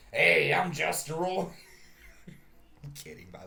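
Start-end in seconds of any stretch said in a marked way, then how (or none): 0.1-1.5 loud, mock-boisterous entrance; Justin Roiland. Joker imagines the actor bursting into a room, mock-boisterous. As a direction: hail the room with a punched, higher "hey". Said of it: "Justin Roiland come in the room and be like".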